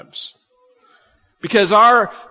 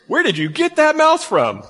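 high mock-scolding parent; a parent scolding a mouthy kid, much higher; 10 semitones higher than usual. Preacher voicing a parent scolding a mouthy child, high and scolding.

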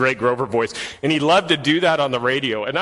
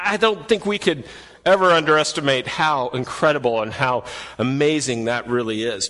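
ordinary talk, nothing marked

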